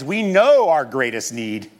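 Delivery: usual speaking voice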